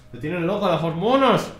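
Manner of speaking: high, tender teasing of the dog; pet talk. Real, not acted. Streamer fondly teasing his dog in heat, high pet talk.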